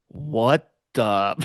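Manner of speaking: slow, drawn-out mock disbelief; dumbfounded. Voicing the captain watching them get out, dumbfounded. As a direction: imitate stunned realization, stretching the words. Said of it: "you could see, like, they get out".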